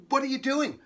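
high, loud, curious question; eager kid's question; curious child. A narrator's reading. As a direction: pipe up like a nosy kid. Curious child asks the writer.

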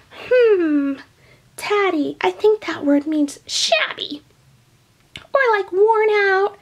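put-on high, squeaky old lady voice; Grandma puppet voice. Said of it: "Grandma, you are right".

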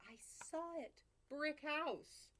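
plain reading voice

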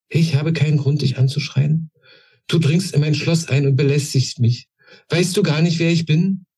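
a queen affronted in her own castle; indignant. A narrator's reading. Queen indignantly scolding the old woman intruder.

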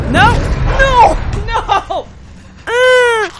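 high, loud half-laughing no; anguished cries. Real, not acted. Gamer losing again, high anguished cries. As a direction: cry out in despair.

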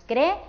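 «creer» partido en cre-er, con pausa entre las dos es; hiato separado. Shown as a demonstration. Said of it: "pronunciar en dos sílabas diferentes". («creer» silabeado, cre... er)

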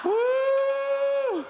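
high ecstatic whoop trailing into laughter; excited whoop and laugh. Real, not acted. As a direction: whoop for joy, then laugh.

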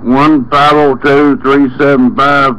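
ordinary talk

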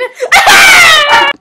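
high loud cackle; shrieking laugh. Real, not acted. Guest breaking into a screaming laugh.

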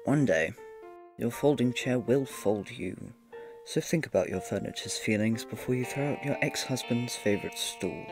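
ordinary talk, nothing marked

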